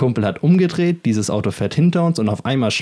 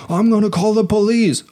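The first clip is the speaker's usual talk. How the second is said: higher, hammed-up threatening voice; mock thug threat; 10 semitones higher than usual. Thug holding up his phone, hammed-up threat.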